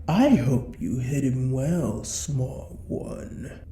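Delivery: ordinary talk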